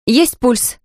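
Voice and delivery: high-pitched urgent call of a nurse; crying out. A narrator's reading. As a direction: call out the news to the whole team. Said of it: "крикнула медсестра".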